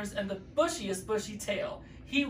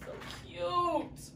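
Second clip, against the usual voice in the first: gushing; 10 semitones higher, 6 dB quieter than usual. Real, not acted. Narrator gushing aside.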